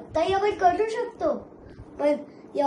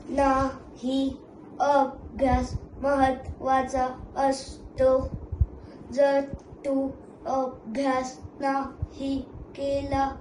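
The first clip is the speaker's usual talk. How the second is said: slow, steady robot warning; a machine scolding the kid about study; lecturing robot; 2 semitones lower, slower than usual. Acted.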